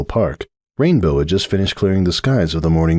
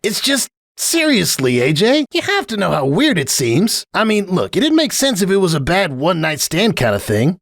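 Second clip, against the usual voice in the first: Rainbow Dash; 12 semitones higher than usual. Acted. Rainbow grumbling to Applejack, high scornful tomboy voice. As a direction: drag out the disgust, pitched high.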